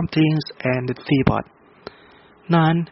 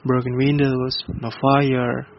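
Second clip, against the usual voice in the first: plain reading voice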